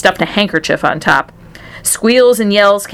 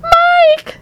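very high, drawn-out mother's scream; piercing falsetto call. A narrator's reading. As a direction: shriek for a lost child. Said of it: "screamed Missus T V".

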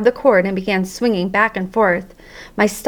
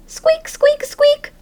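very high little squeaks; hamster squeaks; much slower than usual. Acted. Hamster answering the janitor about music in high squeaks. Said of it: "I tried to tell him".

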